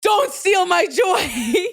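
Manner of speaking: slow, laughing, half-crying plea. Real, not acted. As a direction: beg through laughter not to ruin it. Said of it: "I promise you".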